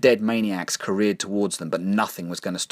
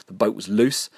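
usual speaking voice

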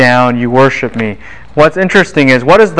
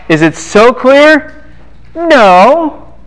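mock high answer; 12 semitones higher, much slower than usual. Teacher mock questioning, high answer.

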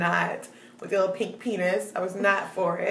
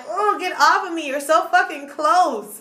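retold irritated protest, pitched higher; annoyed rebuff; 6 semitones higher than usual. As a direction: push away someone too close, mock-annoyed. Acted annoyed rebuff on date.

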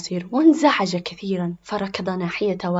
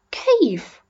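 put-on high, louder child's one-word question; puzzled boy; 8 semitones higher, 5 dB louder, much slower than usual. Boy asks mother to explain, puzzled and high. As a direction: ask it like a baffled child.

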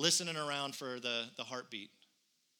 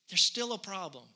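ordinary delivery

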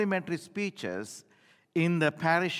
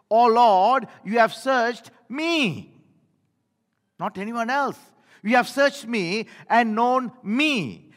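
loud, slow, higher scripture proclamation; fervent reading; 3 semitones higher, 6 dB louder than usual. Acted. Preacher proclaiming Psalm to the church, loud and slow. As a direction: declaim the psalm with full force.

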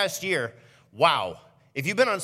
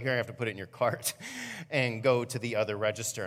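mock cashier; 5 semitones lower, 5 dB quieter than usual. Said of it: "They're like".

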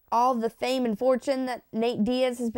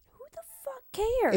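whispered, pitched up, tossed away; dismissive whisper; 13 semitones higher than usual. Real, not acted. Whispering to her co-host that she doesn't care. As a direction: shrug it off under your breath. Brushing off the question.

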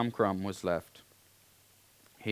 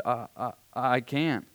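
slow, higher stammer of dread; stammering fear; 4 semitones higher, 4 dB louder, slower than usual. A narrator's reading. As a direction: let the words catch in fright. Boy refuses in panic.

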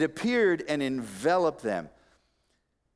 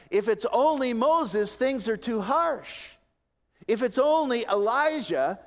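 high, loud pulpit climax; impassioned preaching; 10 semitones higher, 5 dB louder than usual. Real, not acted. Preacher urging the congregation to look to Jesus, high and forceful. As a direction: drive each point home at full voice.

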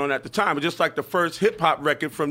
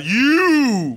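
calling out; 7 semitones higher, 8 dB louder than usual. Real, not acted. Calling out friend outside.

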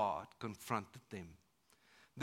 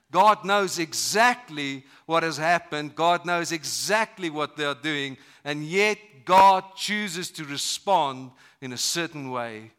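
much louder, slow, hammering each word; forceful preaching; 4 semitones higher, 13 dB louder than usual. Acted. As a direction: proclaim it with full force. Preaching climax, loud and slow.

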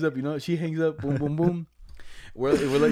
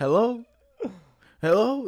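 imitated hello calls; 7 semitones higher, 4 dB louder, slower than usual. Imitating speakerphone call.

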